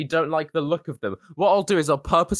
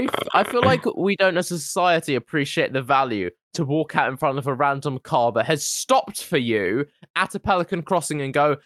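ordinary talk

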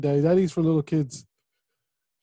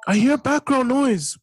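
annoyed surprise; 10 semitones higher, 4 dB louder than usual. Real, not acted. Son annoyed at noise.

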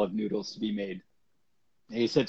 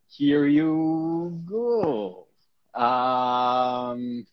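very slow, drawn out; dramatic stall; 2 semitones higher, much slower than usual. Acted. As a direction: stretch it for suspense.

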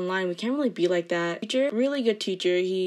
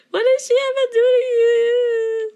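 hammed-up shrieky fake sob, much louder and higher; mock wailing; 9 dB louder, slower than usual. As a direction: wail slowly in comic outrage for the dog. Mock wail defending dog again.

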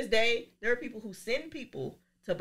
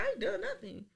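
playing innocent; 12 semitones higher, faster than usual. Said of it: "I said".

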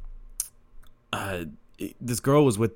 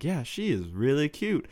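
higher, sing-song mom voice, pushing the point; teasing mom; 7 semitones higher than usual. Retold or imitated. Mom keeps nudging her son about the waitress, higher sing-song. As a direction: needle him like a knowing mom.

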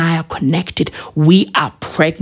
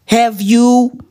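ordinary delivery

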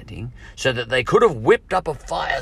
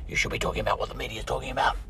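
quick, softer nagging objector; mock critic; 3 semitones higher, 5 dB quieter, much faster than usual. Imagined critic scolding him about the media, quick and soft. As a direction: lightly voice a critic, hurried and softer.

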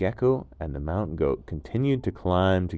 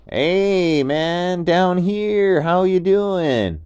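much higher, louder, slow easygoing dude voice; laid-back gecko; 11 semitones higher, 10 dB louder, much slower than usual. Acted. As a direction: call up cheerfully from below. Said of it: "heard something again".

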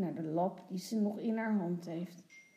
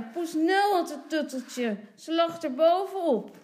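loud, raised-pitch announcement; calling out; 8 semitones higher, 9 dB louder than usual. A narrator's reading. Father points out where the comforter was, calling out. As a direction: announce the find loudly. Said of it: "roept vader".

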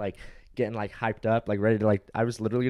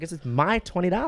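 retold high-pitched indignant retort; 8 semitones higher than usual. Guest retells answering dismissive clerk about his money, indignant. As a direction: insist it's yours, voice jumping higher. Retold argument over the deposit.

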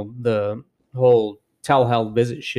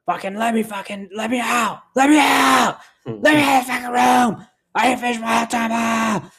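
much higher, building to a shout; imitating her fury; 11 semitones higher than usual. Imitating a furious inmate yelling to be let out, high shout. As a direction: hammer on the door demanding out. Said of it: "Dude, she was fucking pissed".

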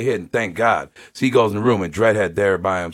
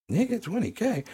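high, rising scoff at "twenty K"; mock disbelief at "twenty K", rising; incredulous; 13 semitones higher, 8 dB quieter than usual. Real, not acted. Scoffing at the offer.

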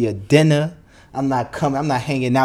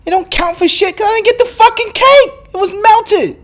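much higher and louder, a real angry yell; angry outburst; 9 dB louder than usual. Real, not acted. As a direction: blow up over the melted cake. Ranting about the melted cake.

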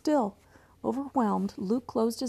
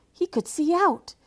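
excited; 9 semitones higher, 6 dB louder than usual. A narrator's reading. Luke discovers the vent view.

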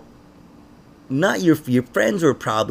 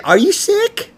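nosy acquaintance; 5 dB louder, slower than usual. Retold or imitated. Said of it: "anybody ask if you were sick".

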